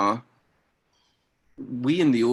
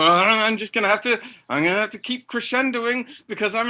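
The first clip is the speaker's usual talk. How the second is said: much higher and louder, a mock strained crescendo; overact, pushing ever upward; imitating an actor stuck on the angry bus; hammy angry actor; 12 semitones higher, 5 dB louder than usual. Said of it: "and you go".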